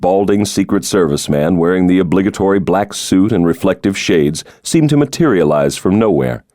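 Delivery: normal talking voice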